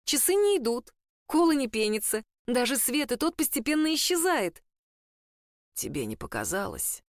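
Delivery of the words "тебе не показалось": low, soft, creaky gramophone rasp; creaky mummy voice. A narrator's reading. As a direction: sound like a worn old record. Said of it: "Голос Джастина прозвучал странно, хрипло-скрипуче". (скрипучий хриплый голос мумии)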